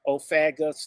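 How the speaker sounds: normal talking voice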